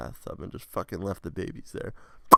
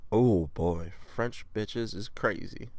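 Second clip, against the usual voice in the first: normal talking voice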